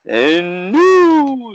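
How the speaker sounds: high, drawn-out title announcement; imitated announcer's long, rising call; ring announcer. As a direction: stretch it out like announcing a new champion. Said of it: "especially when somebody won the title".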